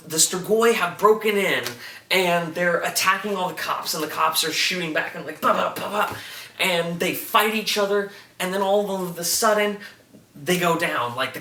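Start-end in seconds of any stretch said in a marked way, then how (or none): 5.4-6.2 lightly voiced bah gunfire noises; mock gunfire. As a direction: cops shooting back, mock gunshot sounds. Imitating gunfire in a recap.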